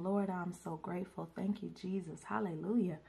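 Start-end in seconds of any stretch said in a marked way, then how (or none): none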